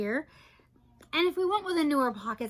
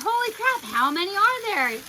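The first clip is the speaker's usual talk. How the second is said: high, louder disbelief; excited, pitched-up astonishment; astonished exclamation; 8 semitones higher, 5 dB louder than usual. Real, not acted. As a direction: blurt it out, amazed. Astonished at number of bags.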